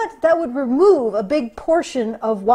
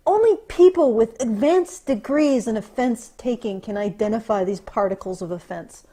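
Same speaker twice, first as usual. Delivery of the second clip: ordinary talk, nothing marked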